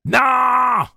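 loud, very high yell; screamed refusal. A narrator's reading. Susanna stopping Eddie from getting up, screaming. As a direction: scream the word back at full voice. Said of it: "hurla-t-elle en réponse".

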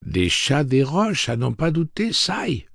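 high, slow and superior, a prissy robot butler; haughty prim android voice; condescending, slightly effeminate. A narrator's reading. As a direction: look down on him from a great height, slowly. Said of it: "de sa voix condescendante et légèrement efféminée".

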